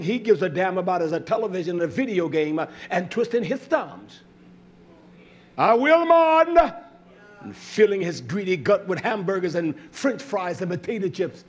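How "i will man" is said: loud, high, drawn-out cry; high preacher's holler; sudden outburst. Real, not acted. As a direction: belt it out slowly from the pulpit.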